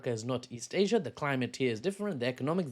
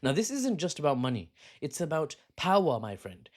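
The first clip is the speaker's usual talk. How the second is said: usual speaking voice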